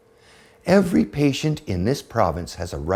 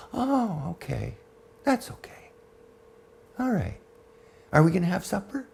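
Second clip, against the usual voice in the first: put-on giddy old mother; 9 semitones higher, 4 dB quieter than usual. Mother giddy after brownie.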